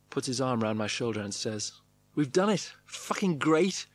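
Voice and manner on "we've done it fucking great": higher, jubilant congratulation; chipper. A narrator's reading. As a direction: crow it out, drawing out the praise. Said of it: "who is as chipper as can be".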